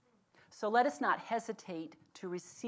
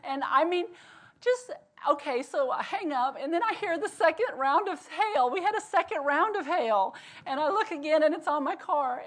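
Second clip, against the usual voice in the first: excited; 8 semitones higher, 8 dB louder than usual. Real, not acted. Second hail hitting her car.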